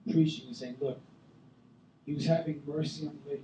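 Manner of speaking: ordinary talk, nothing marked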